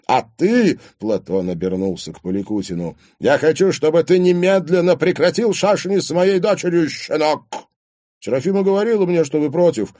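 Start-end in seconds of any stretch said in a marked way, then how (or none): 0.0-0.8 loud, high accusing address; angry challenge. A narrator's reading. Father turns on the boyfriend, angrily. 3.2-7.5 loud, high-pitched ultimatum; angry demand. A narrator's reading. Father warns boyfriend off his daughter, angrily. 8.2-10.0 higher, raised-volume pleading argument; agitated protest. A narrator's reading. Boyfriend asks father why he objects, agitated.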